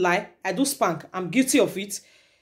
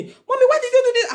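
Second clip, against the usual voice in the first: very high, louder child voice, imitated; scolding daughter; 5 dB louder than usual. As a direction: mimic a little girl telling off her mother. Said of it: "my daughter is scolding me".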